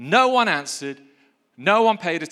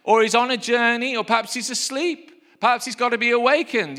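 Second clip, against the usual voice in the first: high, loud sneering jeer; mocking taunt; 7 semitones higher, 3 dB louder than usual. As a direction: taunt them like a playground bully. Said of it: "Elijah mocked them, saying".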